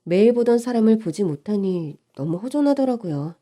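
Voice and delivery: ordinary delivery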